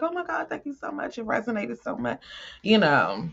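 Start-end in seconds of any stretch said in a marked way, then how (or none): 0.0-2.2 higher, fast, soft grateful gushing; imitating a delighted client; client's thrilled thanks. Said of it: "It was like".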